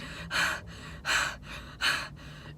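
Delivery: acted heavy, repeated breaths; embarrassed sighing. Standing there so embarrassed after the blunder, acted heavy sighs. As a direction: puff out mortified breaths. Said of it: "I'm just so embarrassed".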